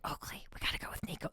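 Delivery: quick urgent whisper. Retold or imitated. Mother urging her son in a quick whisper. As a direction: hiss it fast to your son. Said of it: "and I'm like".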